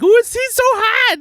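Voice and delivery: high, loud mock fangirl squeal; screaming female fans. Podcaster mimicking ladies screaming for a comedian, high mock squeal. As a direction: imitate a swooning audience shrieking. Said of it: "the ladies are screaming 'cause like".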